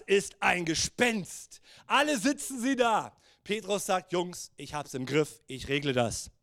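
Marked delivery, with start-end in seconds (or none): 4.1-6.3 low, casual, confident aside to the lads; lightly voiced take-charge guy; cool self-assurance. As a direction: play it down, low and sure. Peter voiced, cocky reassurance.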